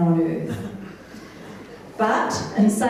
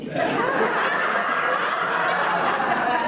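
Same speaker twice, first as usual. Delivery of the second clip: breathy high giggle; high laugh. Real, not acted. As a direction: laugh before reading the list. Laughing at wish list.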